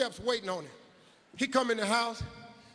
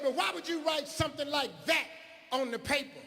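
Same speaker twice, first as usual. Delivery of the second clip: much higher, scolding teacher voice, imitated; high mock teacher; 7 semitones higher than usual. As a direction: pitch up as the disbelieving teacher. Teacher voice in story.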